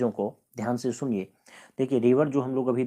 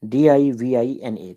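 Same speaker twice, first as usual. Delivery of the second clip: डीआईवीआईएनए spelled out letter by letter, slowly and loudly; spelling out; 7 dB louder, slower than usual. Shown as a demonstration. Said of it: "इसका spelling आपको दिया है". (धीरे और ज़ोर से अक्षर-अक्षर बोलना)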